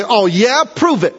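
high sassy dare; mock defiant oh yeah; cocky challenge. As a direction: taunt God to prove it, pitch way up. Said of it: "And I say".

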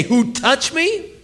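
high, drawn-out disbelieving echo of the question; incredulous mock echo. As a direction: echo it back as if it's absurd. Peter mocking Jesus' question.

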